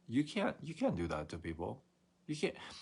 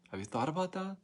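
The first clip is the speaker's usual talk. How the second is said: higher, faster incredulous pushback; exasperated rant; 5 semitones higher, faster than usual. Retold or imitated. Customer pushing back at agent, high and fast. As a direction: pitch up in disbelief at the agent.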